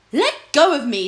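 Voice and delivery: a lord snarling at his clinging companion; angry growl. Acted. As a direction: snarl at him to let go. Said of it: "Spittleworth growled at Flapoon".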